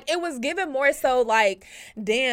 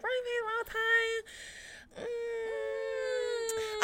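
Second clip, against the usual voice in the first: high, softer, mock-tearful sigh; dramatic lament; 9 semitones higher, 10 dB quieter, much slower than usual. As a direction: moan it like a wistful heroine. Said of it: "It's the facial expression for me".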